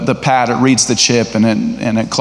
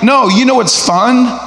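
competitive retort; 6 semitones higher than usual. Acted. Competitor answering someone who wants to play for fun, insistent.

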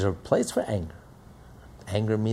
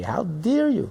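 slow, high, stern reproach; acted parent's outraged rebuke; scolding parent; 11 semitones higher, slower than usual. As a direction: scold the child word by word.

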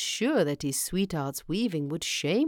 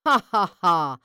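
loud, spoken-out mocking laugh; acted laughter; 8 dB louder than usual. A narrator's reading. Brothers mock Vaco's nutshell, laughing loudly. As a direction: play brothers bursting out laughing. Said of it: "they laughed".

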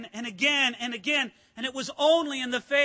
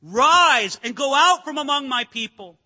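Pharaoh barking the order, much louder; loud royal dismissal, quoted; Pharaoh's loud command; 3 semitones higher, 10 dB louder than usual. Retold or imitated. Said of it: "what Pharaoh said".

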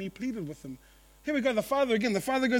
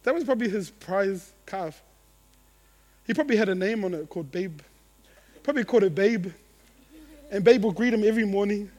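ordinary talk, nothing marked

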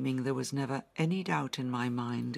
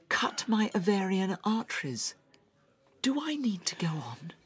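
higher, unvoiced, pained; anguished whisper; 6 semitones higher than usual. [name] telling Sven the worst of her injuries, anguished whisper. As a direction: force it out in a whisper.

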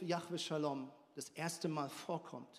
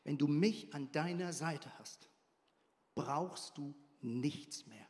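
voiced, very slow and soft; slow, quiet promise; 6 semitones lower, 5 dB quieter than usual. Shown as a demonstration. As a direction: let each word sink in slowly. Repeats promise, slower.

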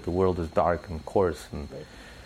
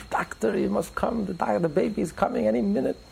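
mock yell; 12 semitones higher than usual. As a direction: call the doctor high and urgent, in play. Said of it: "she yells out in the beautiful French".

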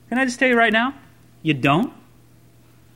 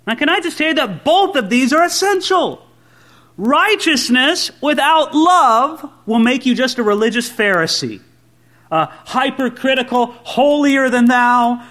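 ordinary delivery

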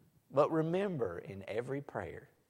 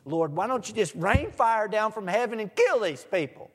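indignant plea; 7 semitones higher, 7 dB louder than usual. Retold or imitated. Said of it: "And John and James said".

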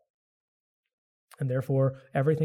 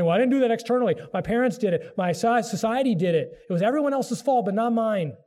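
higher, louder blame-shifting protest; protesting excuse-maker; 6 semitones higher, 4 dB louder than usual. Acted. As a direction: insist it's everyone else's doing, pitched up. Voicing people's excuses for their sin.

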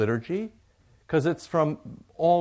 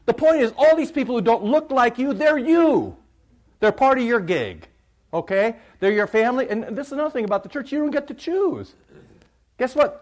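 very high, loud pulpit tirade; full-force preaching rant; 10 semitones higher, 10 dB louder than usual. Acted. Pastor ranting to congregation about food sharers, full-force preaching. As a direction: belt it out to the back pews, exasperated.

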